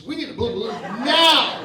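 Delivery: loud laughing yell. Retold or imitated. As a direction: bellow it at the staff. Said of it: "I'm screaming".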